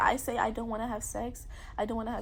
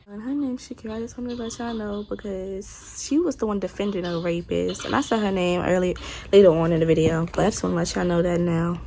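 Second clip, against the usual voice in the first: much louder, fired-up naming and shaming; angry call-out; 12 dB louder than usual. Real, not acted. Naming the girl who defended him, loud angry call-out. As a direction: blast it out, calling her out.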